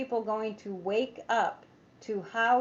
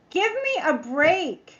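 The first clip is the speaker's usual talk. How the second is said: exasperated scoff; 6 semitones higher, 6 dB louder, slower than usual. Real, not acted. Scoffs at poll claims.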